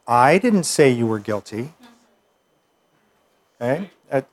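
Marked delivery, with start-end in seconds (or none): 0.1-1.7 "I" drawn out and stressed, the rest lighter. Shown as a demonstration. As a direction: lean on the opening word "I". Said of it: "change the emphasis on those words".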